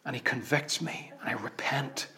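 quiet confession of repenting; hushed. Real, not acted. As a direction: drop to a confessional whisper. Confession dropped very soft.

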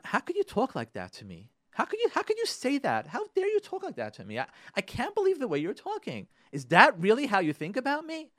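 high-pitched, escalating outrage at a partner; indignant spouse. Acted. Acted spouse in a quarrel.

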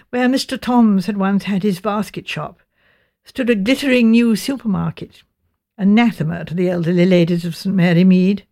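usual speaking voice

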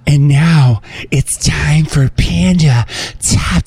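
slow breathy whisper intro; whispered announcer. Pretend. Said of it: "It's talking your normal voice".

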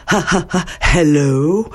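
hello with a strongly breathed h at the start; breathy English H. Shown as a demonstration. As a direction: push air out on the H of hello. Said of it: "Vous avez bien entendu le H anglais". (h anglais bien soufflé sur hello)

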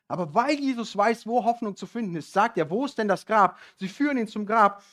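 loud, high, fervent retelling; full-force preaching. Acted. Preacher retelling Lazarus to the congregation, loud and fervent. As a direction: preach it out to the whole hall.

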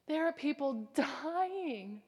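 ordinary talk, nothing marked

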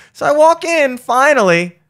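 high, loud indignant rant; outraged. Real, not acted. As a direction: vent the outrage. Outraged retelling.

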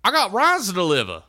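high, loud trucker holler; Doug yelling. Acted. Doug shouting at the creature on the road, high and loud. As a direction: bellow at the creature to clear the road. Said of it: "and he's like".

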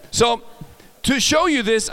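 usual speaking voice

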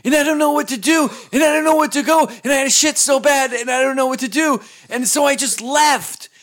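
loud, high, mock-panicked rush of excuses; frantic explaining. Imitating drunk friend explaining where he went, frantic and high. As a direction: blurt it out helplessly, voice pushed up high.

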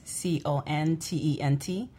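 ordinary talk, nothing marked

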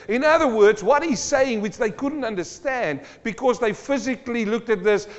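high, loud revival preaching; preaching at full force. Acted. Preacher retelling Jesus and the rich man, high and loud. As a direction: lift the whole room with it. Sustained preaching on Mark ten.

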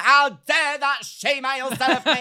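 high, loud, slow hammed-up indignation; mock outrage. Imagined hateful customer, mock outraged. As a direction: ham up a bigoted complaint. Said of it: "a hateful email being like".

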